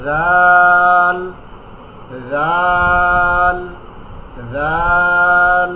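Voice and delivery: জাল held long and soft, tongue tip touching the front teeth; soft drawn-out zal. Shown as a demonstration. Teacher showing the right zal after the wrong takes, slow and soft. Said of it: "soft করে".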